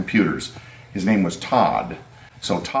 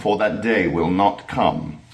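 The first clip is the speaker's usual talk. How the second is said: trailer voice. Shown as a demonstration. Echoes his old trailer line.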